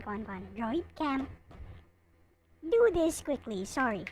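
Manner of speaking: ordinary talk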